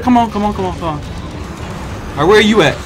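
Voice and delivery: normal talking voice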